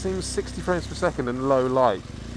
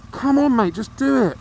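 shouting at driver; 12 semitones higher, 6 dB louder than usual. Real, not acted. Rider shouting at slow driver.

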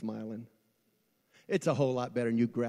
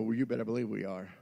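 ordinary delivery

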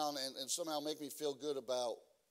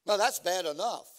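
preaching; 2 semitones higher, 7 dB louder than usual. Acted. Preaching warning with music.

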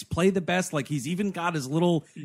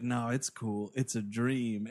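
low, softer coaxing imitation; mock reassurance; 6 semitones lower, 7 dB quieter than usual. Retelling a seductress reassuring the hero, low mock coaxing. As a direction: play the soothing seductress, low and quiet.

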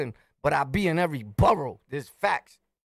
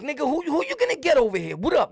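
confrontational challenge; 8 semitones higher, faster than usual. Retold or imitated. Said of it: "So I'm like".

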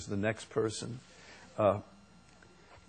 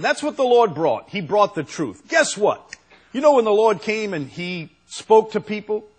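high, loud, insistent delivery; forceful preaching; 10 semitones higher, 8 dB louder than usual. Real, not acted. Preacher tells congregation what the Lord brought, forceful preaching. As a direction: drive it home to the congregation.

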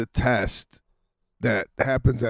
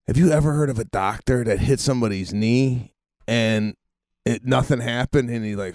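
ordinary talk, nothing marked

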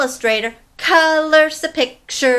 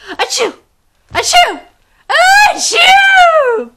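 three sneezes climbing higher and louder; big sneezes; 10 dB louder, much slower than usual. Acted. Monkey sneezing at the blue feather, building sneezes. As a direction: sneeze hard, each one bigger. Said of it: "began to sneeze".

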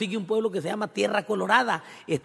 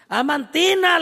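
high, loud call across the cantina; 11 semitones higher, 6 dB louder, slower than usual. Retold or imitated. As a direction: call her name out loud. Calling the cantina owner.

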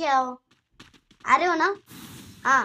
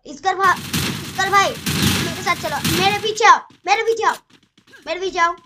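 cover me shout; 4 semitones higher, 6 dB louder than usual. Real, not acted. Calling teammate to follow.

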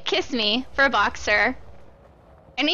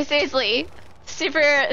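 ordinary talk

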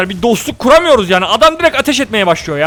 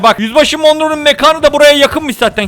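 ordinary talk, nothing marked

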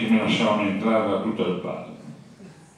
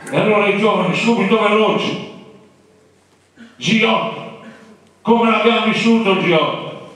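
preaching at full force; 10 semitones higher than usual. Acted. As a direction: rouse the audience against the injustice. (predica infervorata, alta e forte)

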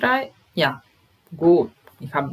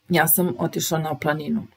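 ordinary delivery

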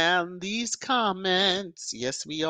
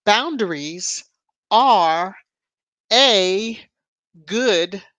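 each word set apart by pauses, louder; emphatic reading; 3 semitones higher, 7 dB louder, much slower than usual. Real, not acted. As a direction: read it out word by word for weight. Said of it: "it was the perfect thing to see".

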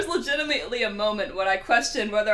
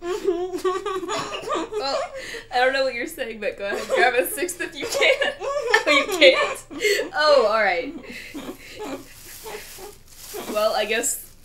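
laughing; 6 semitones higher, 5 dB louder than usual. Real, not acted. Giggling at friend's attempt.

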